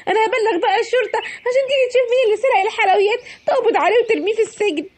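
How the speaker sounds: high, upset mock wail over the theft; the robbed seller cries out for the police, high and upset; dismayed shopkeeper. Seller finds her sweets stolen.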